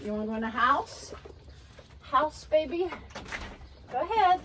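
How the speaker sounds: soft high sing-song to a pet; coaxing pet talk. Real, not acted. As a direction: gently coax the dog inside. Coaxing dog inside.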